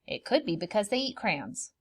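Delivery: usual speaking voice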